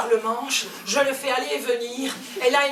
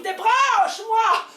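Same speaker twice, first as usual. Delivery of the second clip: kettle crying out; 7 semitones higher, 4 dB louder, slower than usual. Acted. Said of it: "La bouilloire crie".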